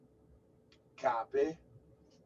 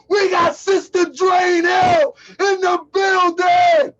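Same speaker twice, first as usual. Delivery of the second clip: high, loud, slow shouted announcement; excited shout; 10 semitones higher, 12 dB louder, much slower than usual. Real, not acted. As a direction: call her name out at full voice. Shouts welcome, then repeats calmer.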